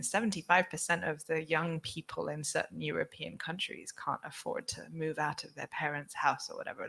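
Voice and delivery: usual speaking voice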